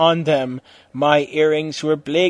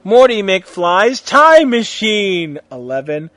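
slow, high, loud announcement; dramatic reveal; 5 semitones higher, 8 dB louder, slower than usual. A narrator's reading. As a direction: unveil it like a big twist.

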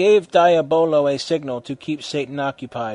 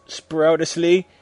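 ordinary delivery

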